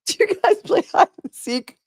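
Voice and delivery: incredulous mock repeat, slowing at the end. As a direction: parrot the question in disbelief. Mocking the detective's question.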